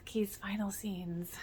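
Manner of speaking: hushed, slow whisper. Real, not acted. Fan reaching the character's last scenes, sorrowful whisper. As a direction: breathe it out as if it hurts to say. Sad recap of character's end.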